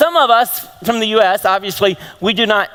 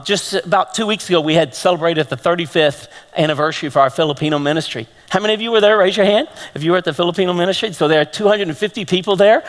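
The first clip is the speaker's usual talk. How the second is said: ordinary talk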